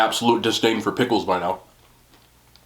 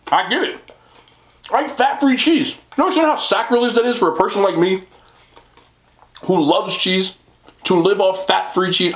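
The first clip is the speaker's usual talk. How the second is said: ordinary talk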